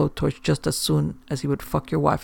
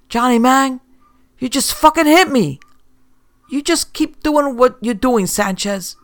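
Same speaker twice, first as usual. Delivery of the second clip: angry thug; 9 semitones higher, 6 dB louder than usual. A narrator's reading. Dealers shouting at each other.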